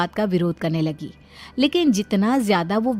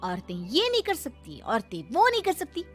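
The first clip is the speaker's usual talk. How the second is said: high, swooping sarcastic retort; indignant bride, shrilly high questioning; defiant young wife; 8 semitones higher than usual. Acted. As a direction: challenge the elder, pitch leaping up.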